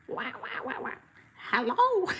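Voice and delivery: half-hearted Donald Duck squawk; bad Donald Duck impression. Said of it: "the worst Donald Duck impression".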